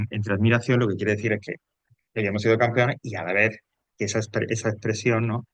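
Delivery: ordinary talk, nothing marked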